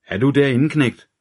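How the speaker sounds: high, loud call through the door; detective calling loudly through the door to his partner. A narrator's reading. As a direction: shout to find his partner inside. Calling into the dark room.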